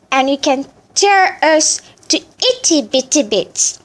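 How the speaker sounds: put-on high, proud old chicken; wise chicken voice. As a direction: boast like a wise old bird. Chicken challenges tiger.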